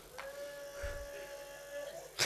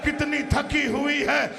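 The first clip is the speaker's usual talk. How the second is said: impassioned majlis preaching; 4 semitones higher than usual. Acted. Preacher telling of the exhausted child in Yazid's court, impassioned lament. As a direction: build the grief, stretching key phrases.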